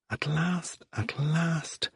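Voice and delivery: breathy, hushed and high; hushed relief. Acted. P J thinks his nightmare is over, hushed relief. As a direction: breathe it out like a held sigh. P J's relief, inner thought.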